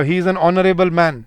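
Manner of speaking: "honorable" stressed, whole line slow; slow model sentence. Shown as a demonstration. Teacher modelling the article with silent h. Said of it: "जैसे मैं बोलता हूँ".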